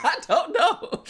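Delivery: high, loud, helpless giggle; words squeezed out through laughter; laughing. Real, not acted. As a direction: crack up while answering. Cracks up at own answer.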